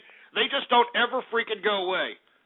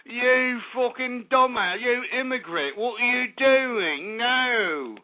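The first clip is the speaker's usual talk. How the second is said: slow, lightly mocking talent-show judge put-down; Simon Cowell; 3 semitones higher, much slower than usual. Host imitating a harsh judge dismissing a caller, slow and sneering. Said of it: "If I was Simon Cowell, I'd be like".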